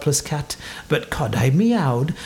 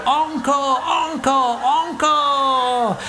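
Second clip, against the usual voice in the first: high crowd chant for more; cheering; 10 semitones higher, much slower than usual. A narrator's reading. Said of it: "and they cheered".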